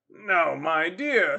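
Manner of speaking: loud, slow, drawn-out refusal; Captain Waters. Acted. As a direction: draw it out like a pompous captain. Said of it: "said Captain Waters".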